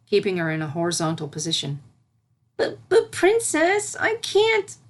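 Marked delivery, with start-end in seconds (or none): 2.6-4.8 high, slow, stammering little voice; scared pleading filly. A narrator's reading. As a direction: plead like a child afraid of the water. Frightened filly pleads with teacher.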